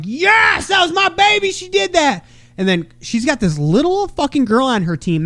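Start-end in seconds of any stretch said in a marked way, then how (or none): 0.0-2.2 proud dad cheer. Retold or imitated. Sports dad cheering his daughter's play, proud shout. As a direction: shout it with pride. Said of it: "I'm like".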